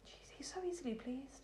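breathy whispered tease; whispered aside. Real, not acted. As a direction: whisper it as a joke. Teasing aside about husband.

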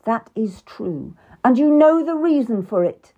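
angry. Acted. King Henry to the queen, angry and loud. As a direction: lash out at her complaints. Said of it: "He was really angry now".

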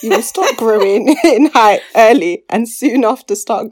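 loud, giggling read-aloud; amused, barely holding it together; reading through laughter. Real, not acted. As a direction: push the words out past the laugh. Laughs while reading own joke.

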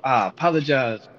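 ordinary delivery

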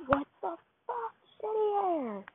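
high, raw yell at the noise outside; yelling out the window. A narrator's reading. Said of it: "he yells out y'all's window".

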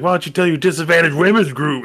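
a voice that suddenly sours; snapping mom. Retold or imitated. Retelling the film mom's heel turn in a mock snap. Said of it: "her voice changes a little".

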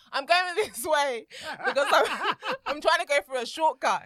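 slow, laughing explanation. Retold or imitated. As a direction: explain while cracking up. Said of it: "I said".

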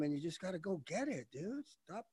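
usual speaking voice

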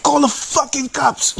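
high-pitched, squawking defiance; re-enacted outburst, voice leaping way up; angry dare. Customer daring clerks who threaten police, angry outburst. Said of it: "So I really got angry".

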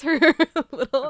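giggling out the words; can't stop laughing mid-sentence; laughing through words. Real, not acted. Laughing while retelling cute scene.

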